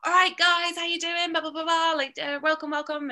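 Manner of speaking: high, loud, rowdy welcome; Vixen DeVille. Shown as a demonstration. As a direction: holler casually to the crowd as host. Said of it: "my Vixen now is a lot more like".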